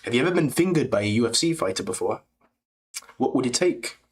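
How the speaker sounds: put-on quick, light English accent; Tom Aspinall impression. Streamer doing English fighter, light English accent. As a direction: tease in a cheeky English accent. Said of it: "I can do a decent Tom Espinal".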